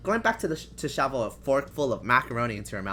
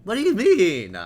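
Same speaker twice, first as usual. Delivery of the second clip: indignant; 11 semitones higher, 11 dB louder than usual. Pretend. Mock indignation, loud high.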